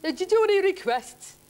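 high, loud heckling question; shouting bar punter. Acted. Bloke calling out to the bar pianist, loud punter voice. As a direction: holler it over the piano. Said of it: "one bloke shouted".

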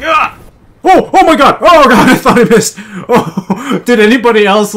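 high, loud relieved shouts; excited screaming. Real, not acted. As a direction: yell in shock and laughter. Nearly missed helicopter.

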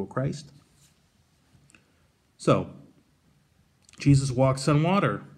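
normal talking voice

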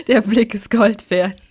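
laughing through the words, slow and loud. Real, not acted. Streamer laughing at the dad's face. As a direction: crack up while saying it.

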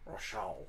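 low, soft "Rashad" with the final consonant swallowed; French-style dropped consonants. Acted. Hugo repeats the dragon's name, "Rashad" without final consonants. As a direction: say "Rashad" with its ending melted into an open vowel. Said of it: "cutting off the last, like, three consonants".

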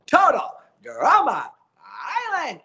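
big shouted show title to close; show sign-off. Shown as a demonstration. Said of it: "then at the end".

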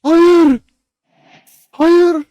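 high, loud despairing no; real anguish, loud and high; anguished scream. Real, not acted. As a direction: scream no as your dog dies. Dog dying in fight.

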